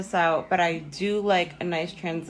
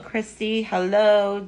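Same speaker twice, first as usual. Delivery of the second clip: bright, cheerful greeting, a bit louder; happy voice; 4 semitones higher, 4 dB louder, slower than usual. Shown as a demonstration. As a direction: greet them like you're truly glad to see them. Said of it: "That was my happy voice".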